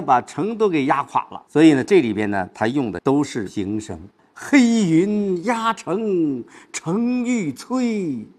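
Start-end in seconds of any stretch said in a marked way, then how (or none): 4.3-8.2 high, very drawn-out recitation; wrong take. Shown as a demonstration. Teacher showing how not to read it, high drawn-out recitation. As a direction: stretch every word out high and singsong. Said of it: "不是".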